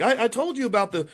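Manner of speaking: ordinary talk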